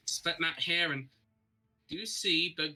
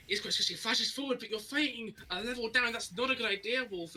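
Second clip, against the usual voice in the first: higher, rising hype over the fight; excited play-by-play; 8 semitones higher than usual. Real, not acted. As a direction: call the fight as it breaks out. Caster calls the first fight.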